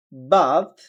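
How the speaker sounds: British "bath" with open AH; British accent. Shown as a demonstration. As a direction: say "bath" as BAHTH, British style. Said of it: "ব্রিটিশরা বলতেছে আ". (British উচ্চারণে)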